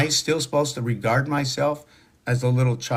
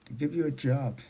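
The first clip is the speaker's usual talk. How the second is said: quiet echo; 10 dB quieter than usual. Real, not acted. Echoing the phrase under breath.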